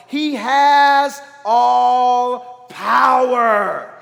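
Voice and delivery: very loud, hugely drawn-out words; slow, high preacher's shout; booming proclamation. Real, not acted. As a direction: proclaim it from the pulpit, stretching every word. Preacher proclaims God's omnipotence.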